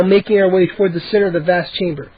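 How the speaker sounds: ordinary delivery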